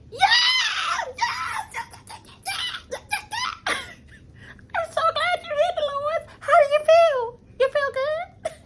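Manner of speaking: very high squealing yes, yes, laughing through it; mock gushing greeting. Mocking Danielle greeting Dolores with a high gushing squeal. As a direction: gush over her with fake sweetness.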